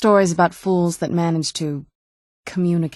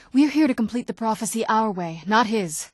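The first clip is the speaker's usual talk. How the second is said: ordinary talk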